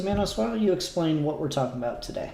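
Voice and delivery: normal talking voice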